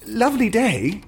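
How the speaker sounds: high, hopeful small talk; fishing for a friendly reply. Acted. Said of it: "he mentioned, hopefully".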